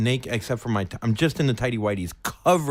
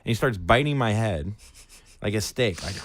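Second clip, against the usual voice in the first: ordinary talk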